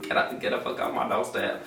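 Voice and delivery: mock whispered curse, voice dropped; muttered under breath. Annoyed at the doorstep preacher, mock whisper. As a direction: hiss it to yourself like a private thought. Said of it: "I was just kind of like".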